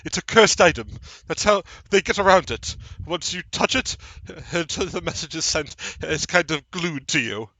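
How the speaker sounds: lightly voiced worried underling; nervous Bartleby. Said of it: "Bartleby looks at you and goes".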